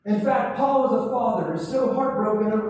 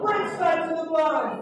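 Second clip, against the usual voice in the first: full-force preaching; 5 semitones higher than usual. Acted. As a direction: whip the congregation up.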